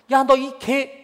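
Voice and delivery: high, loud shouted curse; kid swearing shout. Retold or imitated. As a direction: yell the curse like an angry kid. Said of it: "이렇게".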